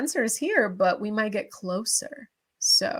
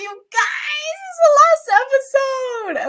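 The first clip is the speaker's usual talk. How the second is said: higher and louder, real excited shout; 8 dB louder, slower than usual. Real, not acted. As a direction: yell it out, thrilled it's the finale. Hyped announcing the finale.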